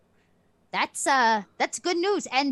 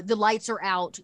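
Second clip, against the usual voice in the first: ordinary talk, nothing marked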